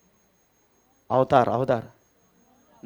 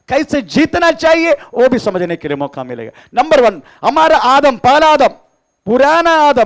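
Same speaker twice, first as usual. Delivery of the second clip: higher and louder, hammering each phrase to the church; full-force preaching; 9 semitones higher, 7 dB louder than usual. Acted. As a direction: testify it out to the congregation, loud and high. (ऊँची और ज़ोरदार आवाज़ में प्रचार)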